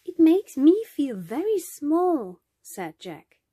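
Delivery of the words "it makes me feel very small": put-on high-pitched child's voice; awed little boy. A narrator's reading. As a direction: voice a small boy in wonder, pitched up. Little boy gazes at huge dome.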